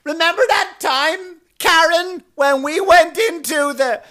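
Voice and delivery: very high, slow, loud gushing voice; hammed-up woman shouting out in a comedy show; loud chatty woman. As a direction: ham up an oblivious woman agreeing with a joke. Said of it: "And then you start your next joke".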